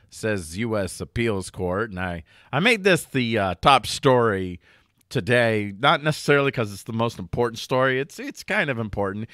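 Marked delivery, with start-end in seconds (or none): none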